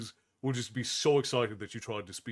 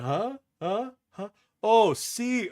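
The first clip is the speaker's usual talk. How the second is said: lightly hammed-up puzzled huh, pitched higher; mock confused local; 9 semitones higher, 5 dB louder, much slower than usual. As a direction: play dumb like you can't understand a word. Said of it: "People be like".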